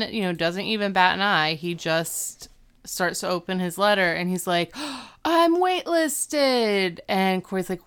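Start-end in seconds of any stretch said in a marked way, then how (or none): 4.7-7.0 gasp, then a drawn-out high cry; hammed-up high, very slow reveal; mock gasping announcement. Acting out Sean opening his letter.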